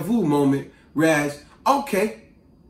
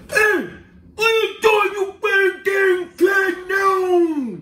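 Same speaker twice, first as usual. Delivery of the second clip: imitated patrol kid; 12 semitones higher, slower than usual. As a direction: bellow like an overexcited young hall monitor. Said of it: "and he said".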